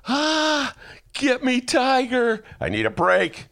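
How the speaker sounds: high, slow, hammed-up whine; whiny plea. Reader of The Stand craving Tiger King, whining. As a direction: beg like a kid needing a fix. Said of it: "all of a sudden I go".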